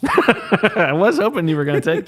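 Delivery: high laughing voice. Real, not acted. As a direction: laughs the whole line out. Laughing at the joke pick.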